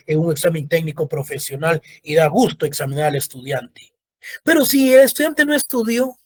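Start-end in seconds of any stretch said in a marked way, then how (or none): none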